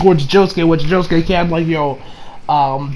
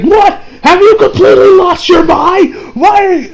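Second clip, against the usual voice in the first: high, loud mock-outraged shout; outraged disbelief; 12 semitones higher, 4 dB louder than usual. Retelling Josuke answering Jotaro's giving up, outraged disbelief. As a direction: act out incredulous yelling, pitch way up.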